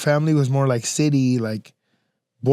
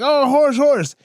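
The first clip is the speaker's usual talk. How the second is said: put-on high childlike calls of horse; excited kids; 9 semitones higher, slower than usual. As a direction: squeal it like a delighted little kid. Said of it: "my kids will be like".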